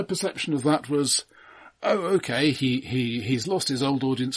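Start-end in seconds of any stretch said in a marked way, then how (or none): none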